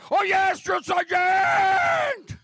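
high, loud reply, Drill Sergeant stretched out very slowly; recruit sounding off. Retold or imitated. Recruit sounding off to the drill sergeant. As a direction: yell it back, dragging the last words.

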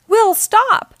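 high, loud exasperated plea; wailing. A narrator's reading. Dad chasing his son with towels, wailing. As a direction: wail it to halt a runaway child. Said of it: "wailed Dad".

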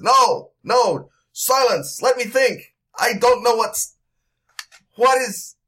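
high, loud frantic demand for silence; overwhelmed, trying to think; frantic silencing. Acted. Confused man silences others.

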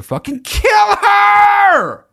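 very high, loud, slowed squeal; a squealing, drawn-out outcry; thrilled shriek. Real, not acted. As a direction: shriek it with delight at the twist. Gleeful retelling of the plot twist.

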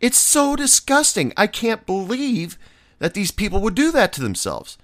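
high, whiny mock of a prissy coworker. Bartender mocking a coworker's complaint, whiny high mimicry. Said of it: "And she goes".

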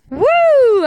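loud, high "woo" cheer; excited whoop. Real, not acted. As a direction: let out a big celebratory whoop. Cheering after the story.

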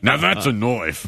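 slow movie-quote Aussie; mock Australian accent. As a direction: drag it out in a hammed-up Australian accent. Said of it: "Just these phrases we know".

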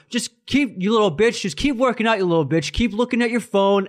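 high-pitched sneering jab, imitating the rude guy; retold taunt, sneered up high; mock taunting insult. Gym guy taunting the narrator at the cable machine: sneering insult.

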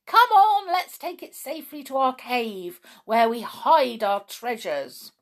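high, loud rallying cry easing off; excited shouting. A narrator's reading. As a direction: urge the gang along eagerly. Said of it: "they shouted".

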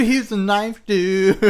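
slow, drawn-out mock hype voice; mock announcer. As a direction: savor each word like a promo. Repeating announcer line.

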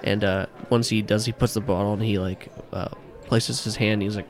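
ordinary talk

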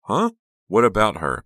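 high, loud, startled demand. A narrator's reading. Becca demands Trish explain about Honey, insistent. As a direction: press for an answer, loud and lifted. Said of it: "Becca demanded".

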